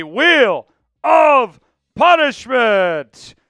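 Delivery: loud, drawn-out dramatic announcement; hammed-up hype for a dreaded prize; game-show announcer shout. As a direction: boom each word separately with big swooping pitch. Hyped announcement of the wheel.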